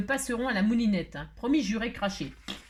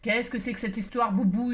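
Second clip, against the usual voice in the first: ordinary delivery, nothing marked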